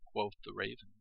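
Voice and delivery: plain reading voice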